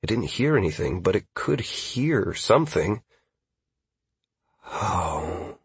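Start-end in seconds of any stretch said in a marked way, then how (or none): 4.6-5.6 very soft, low, breathy question; drawn-out hush inside the head; new thought. Acted. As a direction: murmur it like a thought just forming. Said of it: "birth of new thoughts".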